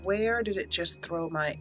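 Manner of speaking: normal talking voice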